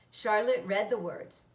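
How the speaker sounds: ordinary delivery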